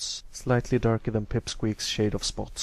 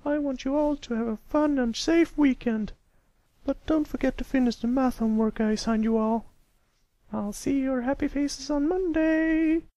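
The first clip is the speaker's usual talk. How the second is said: high, louder cheerful teacher voice; 4 dB louder than usual. A narrator's reading. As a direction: send the class off for the weekend. Teacher addresses class cheerfully.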